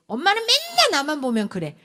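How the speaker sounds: high, loud indignant protest; child's retort. Acted. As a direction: complain back at mum like a hurt child. Child snapping back at mother.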